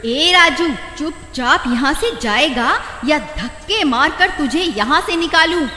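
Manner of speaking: high, loud threatening woman; angry threat. Acted. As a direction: threaten to have him thrown out. Threatening to throw him out.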